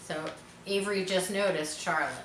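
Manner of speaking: ordinary delivery, nothing marked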